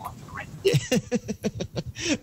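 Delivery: high laugh. Real, not acted. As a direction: burst out laughing. Laughing at redneck rant.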